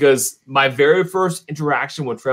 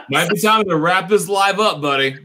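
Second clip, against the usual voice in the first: laughing; 6 semitones higher than usual. Real, not acted. Laughing at crude joke.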